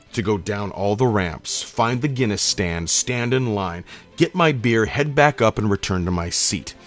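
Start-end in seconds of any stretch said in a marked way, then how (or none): none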